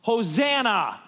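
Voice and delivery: slow, loud, high Hosanna; crowd shouting. Retold or imitated. Crowd greets Jesus at the gate, shouting. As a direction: cry it out like a palm-waving crowd. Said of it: "and they shout".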